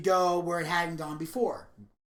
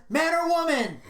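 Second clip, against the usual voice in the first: quick question; 9 semitones higher, 4 dB louder than usual. Retold or imitated. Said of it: "Tom Arnold says".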